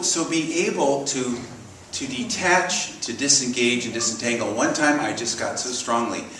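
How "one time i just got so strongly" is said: normal talking voice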